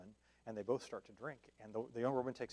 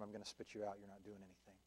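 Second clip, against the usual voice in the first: dropped to a near hush, much softer, a grave warning; 2 semitones lower, 14 dB quieter than usual. Acted. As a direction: let the words sink almost to a whisper. Christ's warning, voiced very softly.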